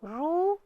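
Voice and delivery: रू with a long drawn-out oo vowel; रू as roo, vowel held long. Shown as a demonstration. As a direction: stretch the ऊ in रू. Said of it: "हम उसका उच्चारण".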